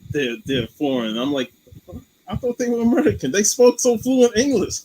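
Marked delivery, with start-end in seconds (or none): 2.3-4.8 high, quick amazed disbelief; mock astonished voice; surprised. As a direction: act out being fooled, eyes wide. Retelling own surprise at actors.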